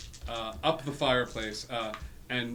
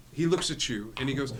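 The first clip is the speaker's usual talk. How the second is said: usual speaking voice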